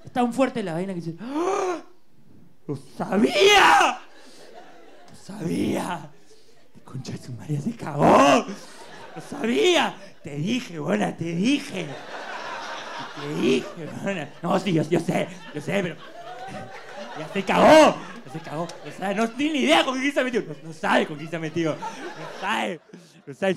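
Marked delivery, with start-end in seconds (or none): 1.2-10.0 shouting "I knew it"; loud, slow, furious triumph; triumphant shout. Acted. Finding proof, exploding. 10.2-23.5 loud laughing gloat; gleeful gloating. Acted. Gloating after finding proof.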